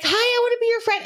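very high-pitched friendly dog; eager dog voice. Retold or imitated. Owner voicing the big dog greeting strangers, high eager dog voice. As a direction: squeak it like an overfriendly dog.

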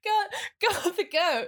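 high, slow Swedish lilt; Swedish accent. Retold or imitated. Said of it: "Is the voice part of it?".

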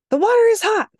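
high, loud daughter complaint; whiny daughter. Retold or imitated. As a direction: complain like an upset kid. Daughter voiced, complaining at mom.

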